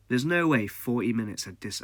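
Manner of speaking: ordinary talk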